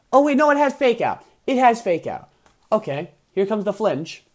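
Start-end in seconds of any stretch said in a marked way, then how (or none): 0.1-2.3 high, loud sudden alarm; high-pitched urgent exclamation; alarmed realization. Real, not acted. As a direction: blurt it as the threat dawns. Sudden alarm at opponent's move.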